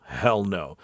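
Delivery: usual speaking voice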